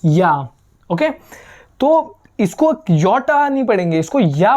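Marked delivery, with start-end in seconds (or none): 0.0-0.3 या said short and low, like ya; teacher models J plus A as या; ja sound. Shown as a demonstration. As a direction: give या as one clean syllable. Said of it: "उसको हम इसको पढ़ेंगे".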